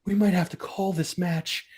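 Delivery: mocking commentators. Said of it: "you hear the commentary go".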